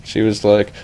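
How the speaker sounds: ordinary delivery, nothing marked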